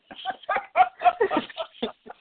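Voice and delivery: very high, loud cackling laugh; shrieky high laughter; helpless laugh. Real, not acted. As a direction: burst out laughing. Laughs at own clarification.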